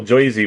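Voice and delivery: "Jersey" said as JOY-zee; mock Joisey pronunciation. Shown as a demonstration. Streamer citing the joke version, "Jersey" as JOY-zee. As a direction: turn "Jersey" into Joisey, oy vowel. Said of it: "make fun of the accent".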